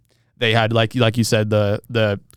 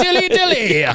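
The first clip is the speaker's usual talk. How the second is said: much higher, louder mock-medieval proclamation; medieval herald; 3 dB louder than usual. Quoting a favorite beer ad line to co-host, hammed-up herald cry. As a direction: bellow it like a hammy king's herald. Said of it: "my favorite line from those commercials is".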